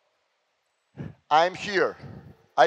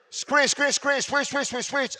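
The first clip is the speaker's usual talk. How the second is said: higher called-out screen and switch; player calling out; 8 semitones higher than usual. Shown as a demonstration. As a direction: show them how to talk on defence. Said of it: "I need to hear your voice".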